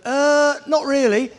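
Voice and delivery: hesitant, scared rider; a touch of nerves before the run; nervous answer. Retold or imitated.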